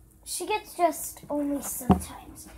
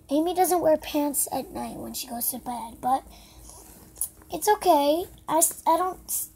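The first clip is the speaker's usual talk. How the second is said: ordinary delivery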